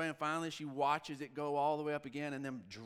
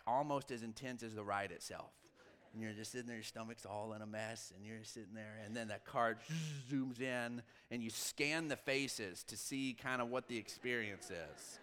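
ordinary talk

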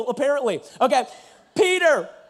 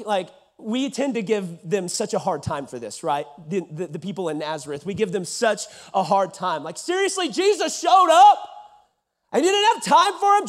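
ordinary talk, nothing marked

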